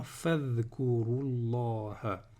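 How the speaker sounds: "fadhkurullaha" run together as one word; slow, linked "fadhkurullaha"; linked reading. Shown as a demonstration. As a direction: join "fadhkurullaha" with no break before Allah. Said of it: "en liaison, je le prononce pas".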